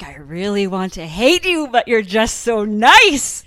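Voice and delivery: loud, slow put-on voice of a coach, a hint of an accent; a mild imitation of a fond, grudging coach; imitating Les. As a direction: lightly voice a fond coach, dragging it out. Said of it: "And he'd be like".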